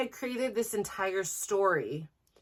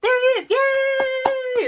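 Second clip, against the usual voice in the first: much higher and louder, a delighted squeal; excited cheer; 9 dB louder, much slower than usual. Retold or imitated. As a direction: spot him on the stream and cheer. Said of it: "'cause I'm like".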